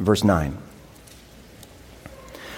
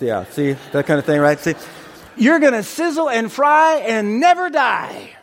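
cheery mock-excited hellfire pitch; high cheery hellfire pitch; gleeful doom neighbor; 6 semitones higher than usual. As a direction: sell damnation like party news.

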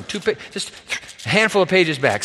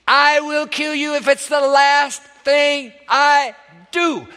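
mock scream; 7 semitones higher than usual. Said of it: "who screams into this torrential downpour".

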